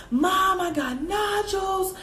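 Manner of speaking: hammed-up sobbing phone call to mom; mock boo-hooing. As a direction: whine to mom like a crying child. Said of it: "Like, I'm just boo-hooing".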